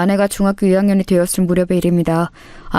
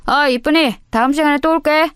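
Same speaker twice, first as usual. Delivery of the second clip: higher, sing-song leering voice; sleazy flirt; 7 semitones higher than usual. Acted. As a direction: leer at her on the way out. Bully flirts while leaving.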